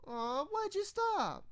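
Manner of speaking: high sing-song pouty Pinkie voice; loud pouting Pinkie. Acted. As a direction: pout and beg like a puppy. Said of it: "Pinkie asked loudly".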